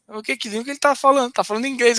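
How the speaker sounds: incredulous question. Retold or imitated. Narrator asks his colleague about the stranger, incredulous. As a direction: ask as if you can't believe it. Retold puzzled exchange with colleague.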